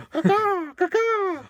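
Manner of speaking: shrill high caw-caw, loud and high; high squawking bird call. Pretend. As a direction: squawk it out like a crow. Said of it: "you should be some kind of Foley artist".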